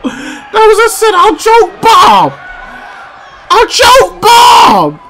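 high, slow, near-crying yell of disbelief; ecstatic shout. Real, not acted. Fan yelling at the screen in disbelief, high ecstatic shout. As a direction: scream it like your hero just survived. Finisher kicked out, fan losing it.

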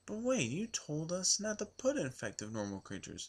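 high-pitched mock protest from a viewer; put-on high voice, challenging the speaker; objecting viewer.

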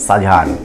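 শাহজাহান shortened to sha-ja-haan, no middle "ho"; everyday shortened pronunciation. Shown as a demonstration. Teacher shows pupils how we really say it, shortened pronunciation. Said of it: "আমরা কি".

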